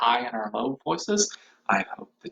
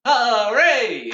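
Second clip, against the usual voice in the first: mock cheer; 10 semitones higher, 4 dB louder than usual. Shown as a demonstration. Cheer at song's end.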